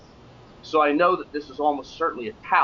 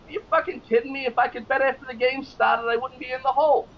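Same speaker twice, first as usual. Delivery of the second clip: put-on high, fast New York accent; fake New York accent; 11 semitones higher than usual. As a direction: fake outrage, New Yorker. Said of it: "giving this fake New York accent".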